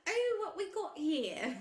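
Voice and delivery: put-on high, slow old Utah lady voice, American accent; hammed-up elderly Utah woman's American accent. Podcaster mimics old Utah lady holding a skull, American accent. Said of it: "in an old lady American accent from Utah".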